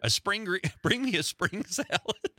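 high-pitched, breaking up mid-line; real giggle pushing the voice up; laughing while reading. Real, not acted. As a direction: crack up as you try to read the verse. Reader cracks up mid-verse.

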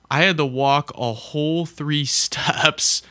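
cracking up mid-sentence; laughing through the words. Real, not acted. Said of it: "I can't even say that with a straight face".

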